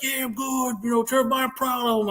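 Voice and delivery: high-pitched imitation of a wounded Marine; wounded tough Marine. Wounded Marine reassuring his buddy, imitated high voice.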